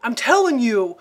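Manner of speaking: loud, high, hammed-up Staten Island accent; mock a film actor's overdone New York accent; bad Staten Island accent. Imitating the detective's ex-fiance in the movie, bad Staten Island accent. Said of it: "that's kinda how he was talking".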